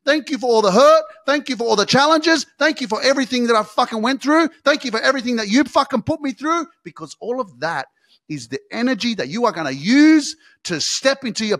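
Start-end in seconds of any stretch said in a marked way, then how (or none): 0.0-6.7 high, loud sarcastic gratitude; loud, fierce thank-you; loud defiant thanks. Retold or imitated. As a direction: shout your thanks at the pain. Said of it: "I'm gonna say".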